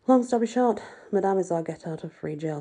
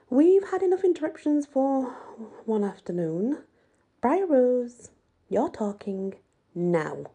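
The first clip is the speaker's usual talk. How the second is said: stern teacher; 6 semitones higher, 3 dB louder than usual. Acted. Madame cuts off interruptions.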